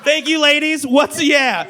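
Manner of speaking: usual speaking voice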